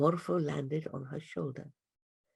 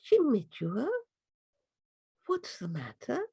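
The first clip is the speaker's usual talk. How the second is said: little voice; 7 semitones higher than usual. Acted. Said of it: "a little voice saying".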